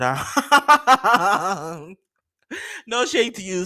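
high-pitched laughter breaking into the words; laughing. Real, not acted. Host joking about [name]'s meal deal, laughing. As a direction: laugh your way through it, pitch way up.

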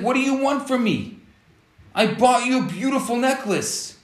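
defending the necklace, fed up; exasperated husband. Retold or imitated. Husband snapping back at his unhappy wife, exasperated.

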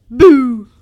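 very loud, sudden boo; booming creature scare; spooky boo. A narrator's reading. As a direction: jump out and scare the listener. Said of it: "yelled the strange green spooky creature".

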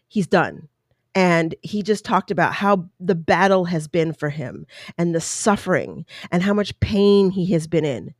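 ordinary talk

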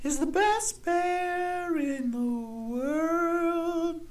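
high, slow cartoon announcer; sing-song it like a kids' ad; hammed-up jingle voice. Co-host chiming in on the bear gag, high and slow. Continues mock Smokey jingle.

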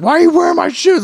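high-pitched, outraged imitation of a sister; mock angry sister. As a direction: act out an annoyed sister's shrill complaint. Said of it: "she got pissed".

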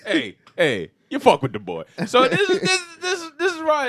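twitchy, laughing street-hustler voice; jittery junkie uncle, cackling; crackhead uncle voice. Pretend. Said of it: "You said that like the crackhead uncle".

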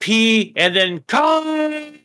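"con" held long and high; drawn-out KAHN. Shown as a demonstration. Texan breaks the word up, "con" held long and high. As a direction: stretch "con" like the Khan yell. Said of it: "like in Wrath of Khan".

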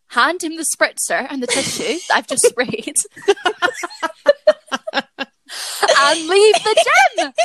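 half-laughing at her own blunder. Real, not acted. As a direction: giggle through the confession. Retelling embarrassing moment, laughing.

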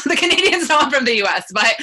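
loud, high, laughing aside; laughing admission. Real, not acted. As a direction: say it through laughter, quick and loud. Joking that Canadians aren't fooled.

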